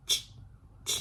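"ch" as a tiny voiceless puff; just a quick burst of air for "ch"; short CH sound. Shown as a demonstration. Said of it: "เท่านี้เลยค่ะ นี่คือเสียง ซีเอช". (พ่นลม "ch" สั้นๆ แค่นั้น)

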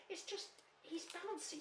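very high, small voice; timid little Piglet. Acted. Piglet excusing Tigger to the others, timid and high. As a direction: pipe up hesitantly, high and tiny.

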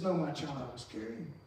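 gentle voice of God. Acted. God answering the dreamer gently.

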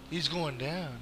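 soft, low threat; low vow. Real, not acted. Gamer vowing to take down his target, low vow.

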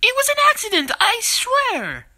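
high-pitched panicked plea; desperate defensive outburst; frantic protest. Acted. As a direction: protest your innocence, pitch jumping up. Pleading innocence when accused.

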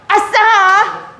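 slow high drawn-out whoop; gleeful cheer. Shown as a demonstration. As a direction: sound young and delighted. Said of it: "저희도 아싸 한번 해볼게요".

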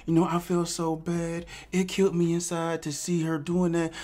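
high, soft whiny mock victim voice; mock crying victim. Mocking the woman's tears, high and whiny. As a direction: whimper it like a fake victim. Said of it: "crying victim the whole goddamn time".

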